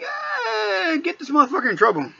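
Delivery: high shout then fast; gleeful plan to get him in trouble; gleeful scheming. Retold or imitated. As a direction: pounce on the chance, fast. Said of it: "So I'm like".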